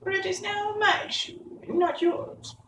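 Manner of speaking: very high-pitched witch voice; retorting witch. A narrator's reading. Witch claims the shoe from Dorothy, defiant retort. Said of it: "retorted the witch".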